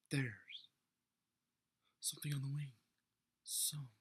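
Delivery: halting delivery with dramatic pauses, trailing into a hushed repeat; William Shatner. Acted. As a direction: break the line up dramatically, then drop to a whisper. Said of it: "I feel like Shatner".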